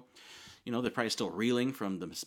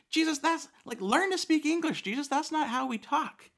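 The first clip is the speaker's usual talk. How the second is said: much higher, louder mock whine; mocking complaint; 5 dB louder than usual. Listeners teasing Jesus about odd wording, mocking whine. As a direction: scoff at him like a snarky teen.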